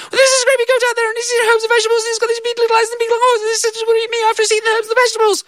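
put-on very high frantic child's voice; gabbled panic; panicked little girl. Girl crying to her mother about the goat, very high and frantic.

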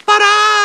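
very high, drawn-out "ta-da" squeal; imitated kid leaping out to startle; brother's jumping-out cry. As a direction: burst out with a squeaky surprise cry. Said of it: "jumped out and went".

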